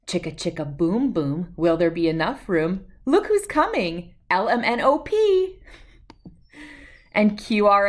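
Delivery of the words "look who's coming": ordinary delivery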